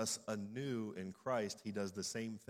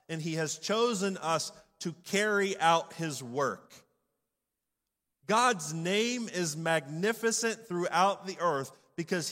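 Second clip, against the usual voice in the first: loud, high, driving sermon delivery; full-force preaching; 7 semitones higher, 9 dB louder than usual. Real, not acted.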